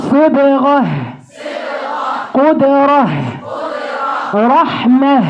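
صبغة قدرة رحمة, each ending with a breathed h; strong final h. Shown as a demonstration. Teacher drilling words that end with a strong h. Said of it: "شوف أنا بنهجها إزاي".

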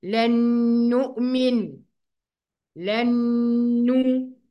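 "لن نؤمن" as lannn-nu'min, n held long; nasal ghunnah. Shown as a demonstration. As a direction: hum through the nose on the n of "لن نو". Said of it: "دي غنة".

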